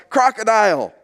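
half-voiced call for help; retold yell. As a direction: imitate someone calling out across a pool. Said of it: "I hear him yell out".

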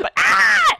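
high piercing screech; bird screech. Shown as a demonstration. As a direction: let out a drunk bird screech. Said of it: "Can I do the noise again".

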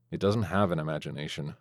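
grumbling under breath about the robot; muttered. A narrator's reading. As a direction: mutter it aside. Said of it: "I muttered".

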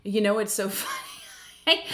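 breaking into high laughter mid-sentence; amused, words giving way to laughter; laughing. Real, not acted. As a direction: crack up while saying it. Cracking up before her pigeon story.

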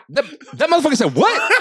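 high, loud, laughing disbelief. Retold or imitated. Host imagines reacting to the message, laughing loud and high. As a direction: squeal it out in disbelief. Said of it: "be like".

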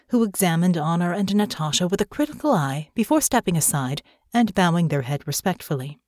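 ordinary delivery, nothing marked

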